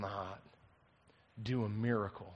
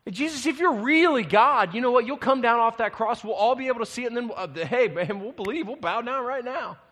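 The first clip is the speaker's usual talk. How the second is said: high, loud sarcastic taunting; scornful leaders taunting, loud and high; mocking tone; 9 semitones higher, 8 dB louder than usual. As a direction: jeer with fake promises. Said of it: "Can you hear the mocking tone in this?".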